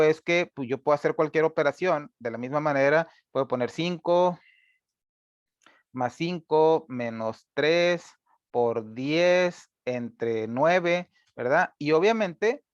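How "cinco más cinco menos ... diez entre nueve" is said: each term spelled out very slowly; deliberate, drawn-out formula dictation. Shown as a demonstration. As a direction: read the formula out slowly as if typing along.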